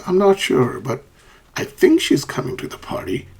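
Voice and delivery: unsure, "not sure" pitched high then dipping; uncertain intonation. Shown as a demonstration. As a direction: hedge through "I think", tentative up to "party".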